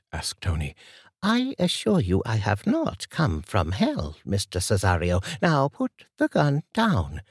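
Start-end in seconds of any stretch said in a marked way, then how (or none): 1.2-7.2 put-on higher, steady older lady's voice; composed older woman Martha. A narrator's reading. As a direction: calmly warn the gunman as an old lady.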